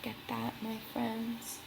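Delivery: hushed aside to the listeners; soft aside. Real, not acted. Reader showing the children the reef, hushed aside. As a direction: point it out gently, quietly. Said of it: "soft aside to children".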